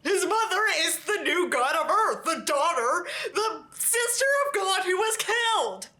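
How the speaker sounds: high, sing-song sarcastic reading; mocking recital. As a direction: parrot the plot twist in a high mocking voice. Mocking the story's plot twist.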